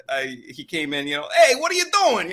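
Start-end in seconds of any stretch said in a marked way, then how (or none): 1.3-2.3 high, loud Brooklyn tough-guy bark; Brooklyn Italian accent. Retold or imitated. Voice actor demoing his dropped Brooklyn Rocket accent. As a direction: throw the line like a Brooklyn wiseguy. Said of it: "I almost sounded Italian".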